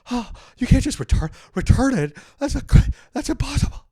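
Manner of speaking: very high, loud comic shriek; hammed-up squealing protest; horrified disbelief. Comic replaying his horror at girlfriend returning couch, hammed-up disbelief.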